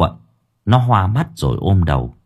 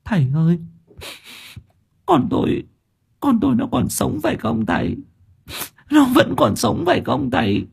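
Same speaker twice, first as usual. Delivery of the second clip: much higher, tearful begging; mother weeps to the master, much higher; sobbing plea; 4 dB quieter than usual. A narrator's reading. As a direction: beg through tears, voice cracking. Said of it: "ngấn lệ ... thút thít".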